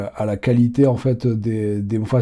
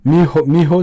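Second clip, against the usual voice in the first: a touch higher and louder mijo, Ecuadorian style; 5 semitones higher, 6 dB louder than usual. Shown as a demonstration. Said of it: "c'est la contraction de mi hijo".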